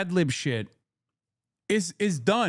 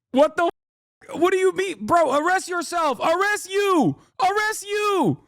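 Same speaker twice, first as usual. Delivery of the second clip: high-pitched mock outrage; hammed-up, exasperated comeback; incredulous retort; 10 semitones higher than usual. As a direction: throw the threat back, pitched way up. Acts out incredulous retort to agent.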